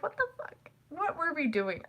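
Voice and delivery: high, soft mock bewilderment; baffled reaction. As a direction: squeak out your disbelief under your breath. Baffled reaction to home video.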